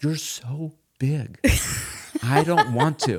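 high, slow dog voice. Retold or imitated. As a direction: drag it out like a lazy dog. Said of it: "Roxy's like".

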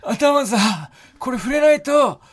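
high, strained mock of a blown-out voice; imitating lost voice. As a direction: mimic your partner with no voice left. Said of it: "この声で、この声で、そう言ってたんです、あなた". (声が潰れた相方の真似)

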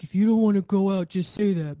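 imitating a man snapping at her; a hint of a sulky date snapping back; retold snap. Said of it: "snapped at me".

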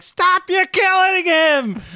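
high, slow, shrill mock scream of a mother; imitating a screaming mother; mom's shrill scream. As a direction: screech it like a frantic mom. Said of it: "the shrill screaming of his mom's voice".

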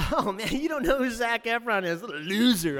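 put-on high, loud taunting kid voice; mocking teenagers. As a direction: jeer at the out-of-touch dad. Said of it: "And they're like".

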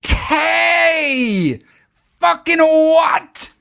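high, disbelieving shriek; shrill, stretched-out what; stunned outrage. Real, not acted. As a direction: scream it in total disbelief. Outraged reaction to movie plot.

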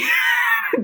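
a squeal of laughter high up; delighted high laugh. Real, not acted. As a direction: burst into a high giggle. Laughing after the dream reply.

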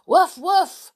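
high, loud woofs; excited dog yaps; dog barking. Acted. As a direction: bark like a far-off dog. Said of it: "said Timmy's voice from far away".

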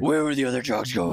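a hint of gruff Batman; lightly voiced Batman growl. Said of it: "used a different voice for the Batman".

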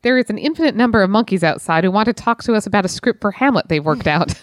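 ordinary talk

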